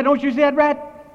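high, loud mimicry of panicked wife; imitated frantic yell. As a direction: shout it back as the terrified wife. Voicing the screaming wife's reply.

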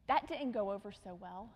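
usual speaking voice

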